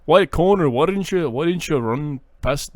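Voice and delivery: hammed-up Irish accent, opening loud and high. Scolding Connor about the potato run, mock Irish accent. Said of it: "Tony's not an Irish name".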